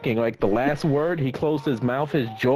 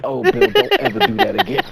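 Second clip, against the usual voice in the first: pleading; 5 dB louder than usual. Retold or imitated. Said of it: "I'm like".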